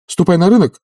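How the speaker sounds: high, loud command; agitated master's instruction; urgent order. A narrator's reading. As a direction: send her off abruptly. Oblomov hurriedly ordering the maid.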